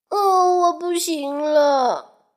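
high, slow, weak moan; fading faint. Acted. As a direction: wilt away as you pass out. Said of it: "小鱼昏了过去". (虚弱地快要晕过去)

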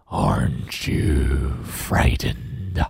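very slow, low monster rasp; big creature trying to scare; deep, scary monster. Acted. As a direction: stretch each word in a menacing growl. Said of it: "asks the monster".

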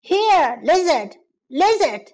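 calling the lizard again. Acted. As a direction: call out searching. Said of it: "he called out".

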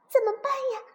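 high, stammering worried question; panicked. Acted. Siblings panic over the fallen dinosaur, high and alarmed. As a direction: fret out loud in alarm.

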